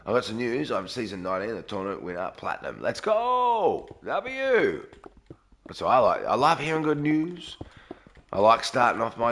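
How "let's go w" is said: celebrating. Real, not acted. Celebrating viewer's win.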